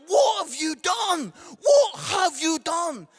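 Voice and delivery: loud, slow disbelief, what have you done; hammed-up shocked friends yelling; incredulous shout. Friends shouting in disbelief at thirty treats, loud and slow. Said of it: "all of my friends were like".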